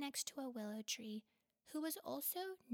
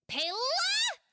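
much higher, loud holler; hollered name; 14 dB louder than usual. Acted. Chulur calls distracted Pela, hollering. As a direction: yank his attention back. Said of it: "Chulur hollered".

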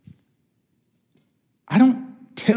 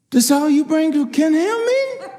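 incredulous guy; 11 semitones higher than usual. Retold or imitated. Event host questioning him, retold.

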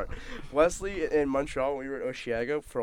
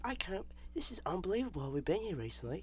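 higher, softer mock British diner; British accent; 6 semitones higher, 11 dB quieter than usual. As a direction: overheard British accent, put on. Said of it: "he just uses English".

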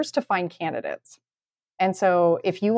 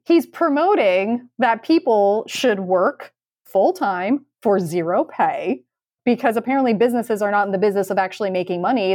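ordinary delivery

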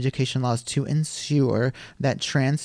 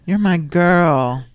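mock chummy girl; 5 semitones higher, 4 dB louder, much slower than usual. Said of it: "She was like".

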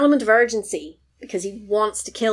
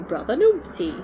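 mock wail; 2 semitones higher than usual. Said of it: "when he's going".

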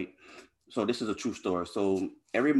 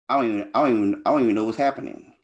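louder, rushed and bewildered, tripping over the words; flustered outburst; 3 semitones higher, 6 dB louder, much faster than usual. Retold or imitated. A man retelling how lost he felt crying in the van, flustered and loud. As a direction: spill it out fast like you can't make sense of it.

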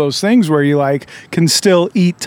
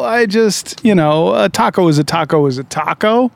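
ordinary talk, nothing marked

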